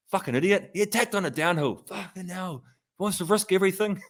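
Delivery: high, mock-scolding partner's voice; mock exasperated fiancée. Imitating the fiancée scolding Pogacar on TV, high and mock-exasperated. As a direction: ham up her fed-up rant, pitched well up. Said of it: "she was just like".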